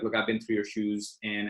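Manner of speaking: ordinary delivery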